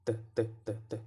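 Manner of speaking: short repeated "d" taps; isolated D sound. Shown as a demonstration. Teacher isolates the sound often heard, repeating short "d" sounds. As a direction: tap out "d" as a bare consonant, over and over. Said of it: "to hear a D there".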